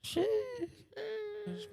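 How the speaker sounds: put-on stretched falsetto sheesh, boy. Echoing the co-host's hype, long falsetto squeal.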